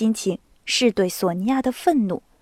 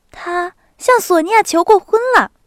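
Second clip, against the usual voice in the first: 兴奋的少女; 8 semitones higher, 6 dB louder than usual. A narrator's reading. 娜达莎报告消息.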